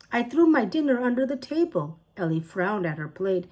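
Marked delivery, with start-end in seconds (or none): 0.1-2.0 high, fast baffled protest; high quick bewildered child. A narrator's reading. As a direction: rush it in disbelief. Ellie baffled by magic.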